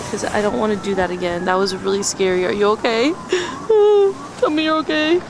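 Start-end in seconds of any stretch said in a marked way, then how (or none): 2.5-3.1 high, anxious checking on it; worried fuss over camera. Real, not acted. 3.2-4.3 shaky, high nervous laugh. Real, not acted. Dropped camera, nervous laugh. 4.4-5.2 high, anxious checking on it; worried fuss over camera. Real, not acted.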